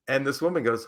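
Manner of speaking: normal talking voice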